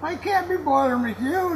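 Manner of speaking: usual speaking voice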